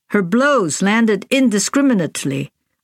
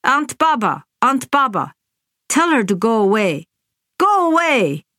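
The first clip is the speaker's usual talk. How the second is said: put-on howling child; 4 semitones higher than usual. A narrator's reading. Crying child begging her aunt.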